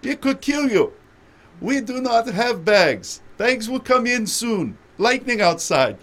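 high, loud laughing mock of an Indian announcer; laughing through a high mimicry of her accent; imitating Indian accent. Airport announcer scolding passengers, high laughing Indian accent mimicry. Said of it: "I could imitate her".

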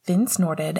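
usual speaking voice

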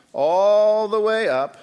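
"all" stretched long, high and loud; drawn-out emphasis. Shown as a demonstration. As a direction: sweep slowly and loudly through "all the way up". Demonstrating wrapping from feet to head.

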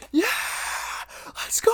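hammed-up fan yell; imitated screaming. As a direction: re-enact a crowd scream, stretched out. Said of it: "because I was screaming like".

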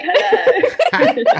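very high pet-talk hello; greeting a dog. Pretend. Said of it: "Maybe if I pretend you're a dog".

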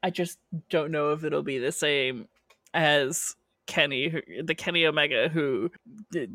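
ordinary delivery

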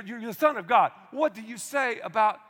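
usual speaking voice

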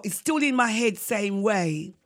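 normal talking voice

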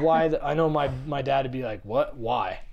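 normal talking voice

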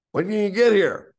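high, loud imitation of a phone shout; yelling, hard of hearing. Half-deaf club boss summoning the young caller, yelling. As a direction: shout the question down the line. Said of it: "he's yelling at me 'cause he's sort of deaf".

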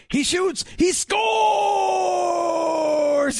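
high radio goal call, last word stretched long; excited play-by-play shout, held on the final word; imitated hockey call. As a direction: call the goal like a hockey announcer, drawing out "scores". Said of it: "he had a way of saying".

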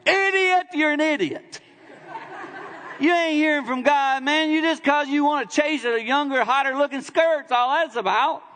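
high, loud scolding call of idiot; retold rebuke. Pastor telling a congregant who wants divorce, loudly mocking. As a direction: scold the man half laughing at his nonsense. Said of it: "And then I went".

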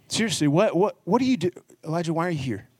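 high, mocking incredulous questioning; sarcastic. Shown as a demonstration. God challenging Elijah as if being mean, sarcastic. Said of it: "he's not saying it sarcastically".

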